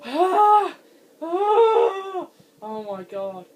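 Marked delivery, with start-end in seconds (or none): 0.1-2.3 high, loud startled yelps; frightened cries. Real, not acted. Gamer startled in the dark, crying out in fright. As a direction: yelp in sudden fright.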